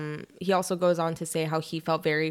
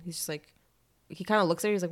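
normal talking voice

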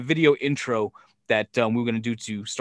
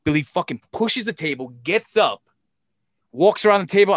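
building up; 5 semitones higher, 5 dB louder than usual. Real, not acted. Building tension in retelling.

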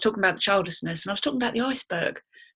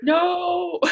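high, loud mock "no"; hammed-up cry of disbelief, getting louder; imitating a co-host's reaction; 11 semitones higher, 4 dB louder than usual. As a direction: mimic her exclaiming, pitched right up. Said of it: "she was just going".

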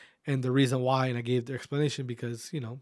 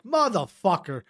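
higher, a bit louder mock outburst of frustration; retold anger; 9 semitones higher, 3 dB louder than usual. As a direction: replay the fury, voice climbing higher. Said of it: "And I got mad again".

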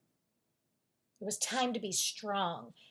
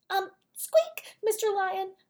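frightened squeak; 8 semitones higher, 6 dB louder than usual. Acted. Mouse frightened before the lion.

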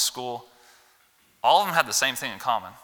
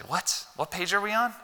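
confused students; 8 semitones higher, 4 dB quieter than usual. Retold or imitated. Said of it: "they'll say".

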